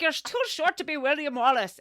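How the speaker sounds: high, loud mock Scottish jeer; mock Scottish accent. Retelling a Braveheart scene, a Scots soldier sneers in a mock Scottish accent. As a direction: sneer it in a hammed-up Scottish brogue. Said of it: "I am so not, um, Scottish accent".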